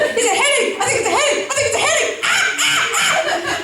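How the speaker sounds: mock hysterical eight-year-old; panicked kid. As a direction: babble it like a terrified child. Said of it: "and so I'm like".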